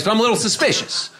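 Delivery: ordinary talk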